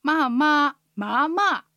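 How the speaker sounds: まあまあ、まあまあ sung out as falling, level, rising, falling tones; tone pattern demo. Shown as a demonstration. Teacher showing the tone flow on まあ alone, exaggerated tones. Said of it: "アクセントの流れを確認すると".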